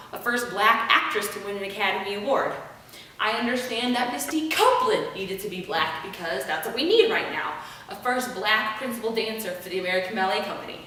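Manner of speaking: usual speaking voice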